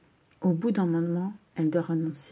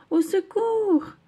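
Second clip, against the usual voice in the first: high, slow, louder cry for help; 14 semitones higher, 5 dB louder, slower than usual. Acted. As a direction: call out in panic, dragging it. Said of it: "crie Océane". (appel au secours aigu et lent)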